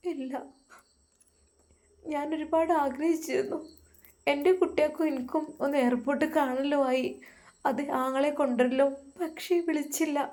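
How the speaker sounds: a wife quietly admitting her disappointment; hurt wife. Acted.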